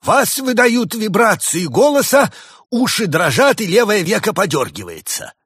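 high, loud, stretched-out pronouncement; booming accusation. Acted. Spirit exposes the lying doctor, booming accusation. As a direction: boom out the evidence like a judge of spirits.

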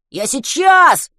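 loud drawn-out call; shouted reply. A narrator's reading. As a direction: call back across the yard. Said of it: "крикнул он в ответ".

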